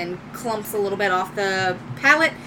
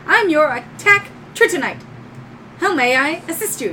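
higher robot helper voice; attack droid; 5 semitones higher than usual. Acted. Droid introducing itself to new owner, high robotic voice. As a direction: announce yourself, then offer service as a robot. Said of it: "walks up and goes".

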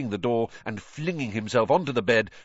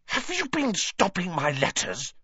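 higher-pitched outraged question; indignant accusation; 8 semitones higher than usual. Acted. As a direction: accuse him, appalled. Boy accuses the elf.

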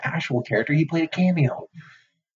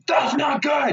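stern teacher; 10 semitones higher, much slower than usual. Retold or imitated. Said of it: "He's like".

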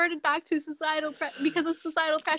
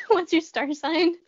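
put-on amused echo of an Irish accent, higher and slow; copied Irish accent; 5 semitones higher, 3 dB louder, slower than usual. Co-host echoing the drunk lady's line, mock Irish accent. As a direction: parrot the weird Irish accent back. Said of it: "In that weird accent".

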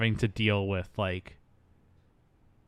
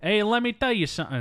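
put-on higher, brash New York accent; hammed-up New York tough-guy accent; 10 semitones higher than usual. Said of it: "That's, that's New York".

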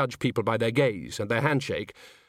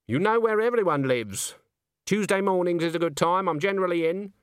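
put-on brisk old woman; 6 semitones higher than usual. Granny voiced in story.

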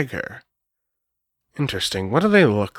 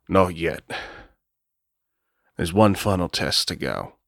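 low Baron voice; 7 semitones lower, 6 dB quieter than usual. Acted. Ruben answers the other vampire.

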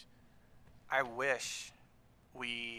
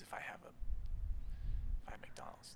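whisper; 5 dB quieter, slower than usual. Real, not acted. Whispered aside.

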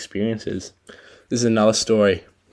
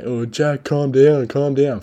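higher, louder mock-soothing mate voice; hint of a cheeky mate saying calm down; teasing mate; 6 semitones higher, 7 dB louder, slower than usual. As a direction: lightly imitate a mate telling you to relax. Imitating mate teasing him in sea.

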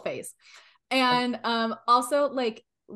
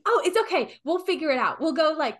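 eager reassurance; 6 semitones higher, 4 dB louder than usual. Acted. Player eagerly reassures Willow in character.

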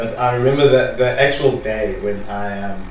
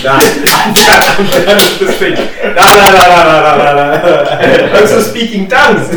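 laughing panic; 7 semitones higher, 11 dB louder than usual. Retold or imitated. Panicked kid refusing, retold.

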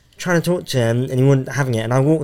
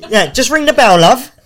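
mock exasperated driver; 10 semitones higher, 6 dB louder, slower than usual. Mocking bus passenger shouting.

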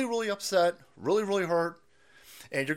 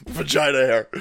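normal talking voice

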